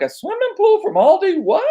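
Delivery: high, incredulous squeal; surprised disbelief. Retold or imitated. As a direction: say it like you can't believe it. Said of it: "I was like".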